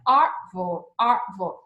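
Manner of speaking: ARVO said as "ah-vo", no R sound; R dropped. Shown as a demonstration. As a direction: say ARVO the Australian way, R left silent. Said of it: "because they don't say the R".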